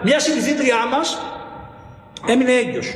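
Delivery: ordinary delivery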